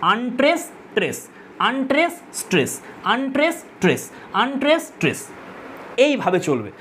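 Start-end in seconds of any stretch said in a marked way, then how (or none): 0.0-5.3 a measured iambic beat, each আনট্রেস leading up into trace; unstressed-stressed beat. Shown as a demonstration. Teacher demonstrates iambic rhythm to students, weak আনট্রেস then strong trace. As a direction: alternate weak আনট্রেস and strong trace, pausing between pairs. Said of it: "দ্বিতীয়টাতে stress পড়বে".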